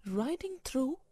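ordinary delivery

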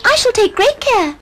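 put-on high sweet girl voice; little girl voice.

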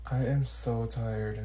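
slow, soft, sleepy; tired. Acted. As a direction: drag it out wearily. Sleepy sigh.

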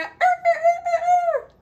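high, loud rooster cry; rooster crow. Pretend. Teacher crows like a rooster for children. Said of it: "Let's do rooster".

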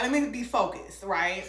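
ordinary delivery

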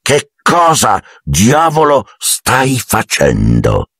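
very slow, word-by-word threat; menacing, through gritted teeth. A narrator's reading. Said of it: "digrignando i denti e avvicinando minacciosamente". (ringhio minaccioso, parola per parola)

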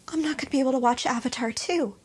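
ordinary delivery

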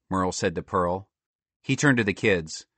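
usual speaking voice

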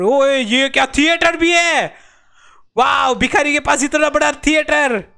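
high, drawn-out amazed cry; amazed excitement. Real, not acted. Gamer discovers a theater at home, amazed excitement. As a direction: marvel loudly at the find.

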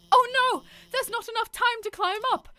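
high, put-on British alarm; high British game character. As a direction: pitch it up into mock-British dismay. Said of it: "why did she turn British".

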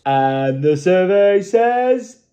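slow, drawn-out game show reveal; game show host. Pretend. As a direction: build suspense like a quiz host.

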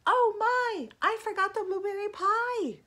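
high, loud gasp of realisation; sudden dismay. A narrator's reading. Mom packing the picnic remembers the missing pie, dismayed. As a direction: exclaim it like a storybook mom in a fluster.

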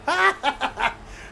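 high, delighted burst of laughter; giddy laugh. Real, not acted. Gamer laughing after a hat trick, high and giddy. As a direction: laugh with pure joy.

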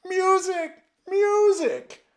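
piercing high scream at full volume. A narrator's reading. Terrified emperor screaming for music as Death stares. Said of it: "screamed the emperor".

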